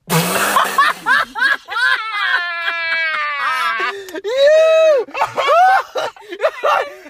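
loud, high, long cackling fit; uncontrollable laughter. Real, not acted. Friend losing it over the name Roberta, uncontrollable laughter. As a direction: burst out in helpless shrieking laughter.

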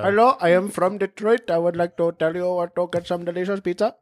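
put-on Indian accent; mock Indian accent passed off as Detroit. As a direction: introduce yourself in a comic Indian accent. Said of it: "I'm sure there are some fine Indian folk".

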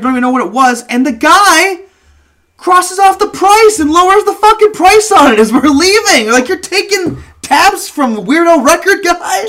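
high, loud comic rant; worked-up, shouted indignation; incredulous outrage. Real, not acted. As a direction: protest it in disbelief, pitch way up. Outraged at the price being lowered.

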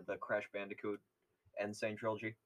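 usual speaking voice

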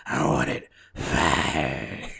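very slow, low growled roar; gruff wrestling-boss bellow; Vince McMahon. Acted. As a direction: drag the last word out in a throat-straining growl. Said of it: "can't do that for long".

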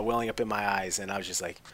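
usual speaking voice

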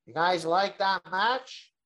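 mimicked nasal wrestler promo; nasally impression. Mimicking wrestler announcing retirement on the mic, nasally. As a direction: put it in your nose, stretching the question. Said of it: "he talks, like, all nasally".

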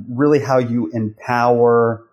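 ordinary talk

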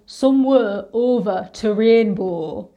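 slow Northern English buzz phrase, "rainbow" with flat northern vowels; Northern English accent. Shown as a demonstration. Drama school grad demonstrating Northern English accent, slowly. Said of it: "getting into a British Northern accent".

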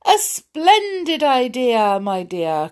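high, slow cheery call; king calling out to his wife. Acted. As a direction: call up to her sweetly. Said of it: "called the king".

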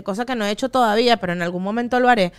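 usual speaking voice

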